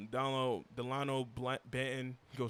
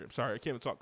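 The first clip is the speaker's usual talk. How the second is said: ordinary talk, nothing marked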